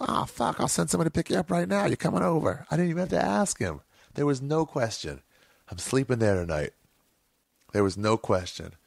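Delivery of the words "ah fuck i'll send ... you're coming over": voicing big friend, higher, fast. Voicing Ralphie on phone.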